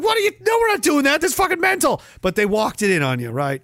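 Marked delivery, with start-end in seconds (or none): 0.0-2.0 mock outraged shout. Mimicking people rejecting a plan, high outraged shout. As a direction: shout like the indignant crowd. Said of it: "They'd be like".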